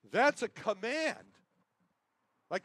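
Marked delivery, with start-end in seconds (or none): 0.1-1.3 emphatic. Real, not acted. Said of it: "preaching the point".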